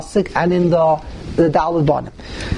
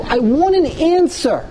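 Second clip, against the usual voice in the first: plea; 7 semitones higher than usual. Acted. Repeated plea, rising.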